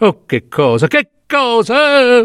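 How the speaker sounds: high, slow, half laughing in despair; broken despairing cry. A narrator's reading. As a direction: voice cracking into a bitter laugh. Mother's breakdown in despair. (grido disperato, lento e alto, con una risata amara)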